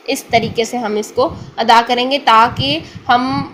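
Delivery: ordinary talk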